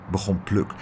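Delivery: plain reading voice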